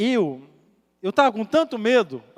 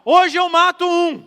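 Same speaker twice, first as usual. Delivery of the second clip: slow, loud, much higher threatening shout; drunk man's yell; 9 semitones higher, 10 dB louder, slower than usual. Retold or imitated. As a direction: bellow it like an angry drunk with a machete. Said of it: "E ele dá um grito assim". (grito ameaçador de bêbado, lento e alto)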